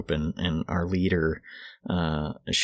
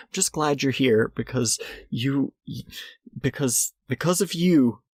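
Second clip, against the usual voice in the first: higher, halting, tearful gratitude; slow, faltering, pitched-up sincerity; upset leader; 7 semitones higher than usual. Retold or imitated. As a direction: imitate someone upset and clinging to hope. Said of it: "She's upset. She's like".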